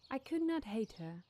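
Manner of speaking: usual speaking voice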